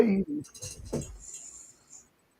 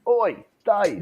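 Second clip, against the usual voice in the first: mock London accent; 8 semitones higher than usual. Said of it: "your London accent".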